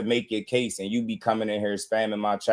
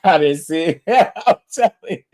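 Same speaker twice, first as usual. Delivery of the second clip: laughing; 6 semitones higher, 6 dB louder than usual. Real, not acted. Laughing at the chat.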